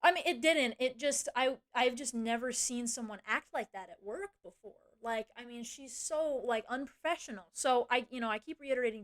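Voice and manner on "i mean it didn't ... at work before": usual speaking voice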